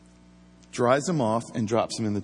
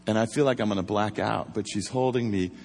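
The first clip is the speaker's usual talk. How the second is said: ordinary talk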